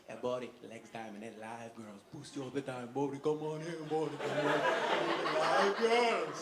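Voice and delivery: low, droning street tout; foreign strip-bar barker. Retold or imitated. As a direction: imitate a weird little foreign flyer man. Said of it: "foreign man gnome".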